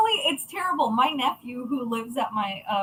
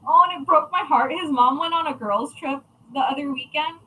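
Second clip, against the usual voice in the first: ordinary delivery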